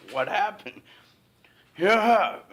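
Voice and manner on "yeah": cashier's yeah. Retold or imitated. Cashier voiced in story.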